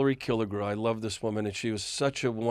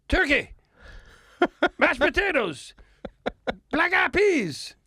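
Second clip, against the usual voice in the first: [name] yelling the menu. Retold or imitated. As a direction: belt the dinner menu out like a song, way up high. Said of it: "He's like he's singing it to me".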